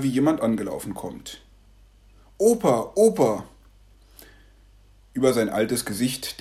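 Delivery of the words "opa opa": put-on high, loud child's call. Grandson running up calling his grandpa, high and loud. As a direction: call out to grandpa like an excited boy.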